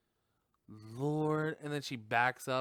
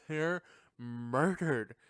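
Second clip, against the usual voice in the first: normal talking voice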